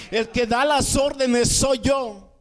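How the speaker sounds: high, slow, emphatic declaration; autoridad divina. Retold or imitated. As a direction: assert God's command over David. Dios reprende a David. (declaración firme y enfática)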